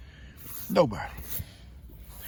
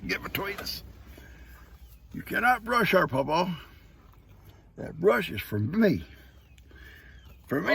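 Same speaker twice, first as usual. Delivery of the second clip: higher jealous goat voice; 7 semitones higher than usual. Acted.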